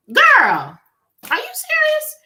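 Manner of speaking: can't believe what she's hearing; shocked disbelief. Retold or imitated. Shocked at friend's rudeness.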